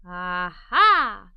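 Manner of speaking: loud, low Aha of discovery; excited aha. Acted. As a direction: burst out with the idea. Child hits on new idea.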